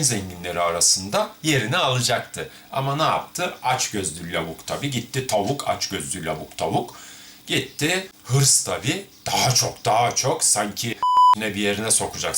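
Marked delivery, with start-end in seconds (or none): none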